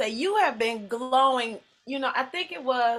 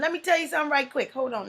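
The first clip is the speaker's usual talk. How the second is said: ordinary talk, nothing marked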